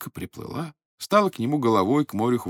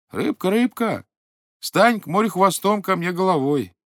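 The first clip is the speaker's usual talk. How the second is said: calling out; 7 semitones higher than usual. Acted. Old man calls the fish again, calling out. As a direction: call the fish across the sea.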